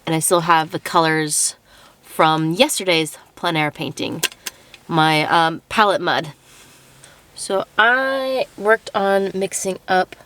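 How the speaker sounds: ordinary delivery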